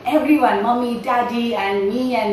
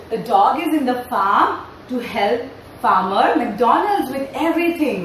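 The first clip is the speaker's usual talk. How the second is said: ordinary talk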